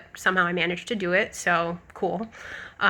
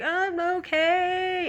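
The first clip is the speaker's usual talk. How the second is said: high, slow, drawn-out mumble; imitated groggy mumble; 9 semitones higher, much slower than usual. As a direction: mimic a drugged patient slurring an answer. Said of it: "every time I would mumble".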